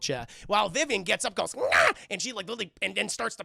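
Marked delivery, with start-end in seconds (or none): 1.5-1.9 short, high, loud creature yell; sudden imitated feral cry; mock attack screech. Vivian gets up to pounce, mock screech. Mimics girl springing up.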